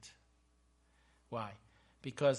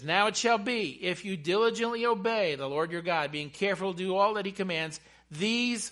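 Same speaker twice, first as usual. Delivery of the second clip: loud, higher scripture declamation; preached scripture reading; 5 semitones higher, 8 dB louder than usual. Acted. Preacher reads Moses' words to the congregation, proclaiming. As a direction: proclaim the promise with force.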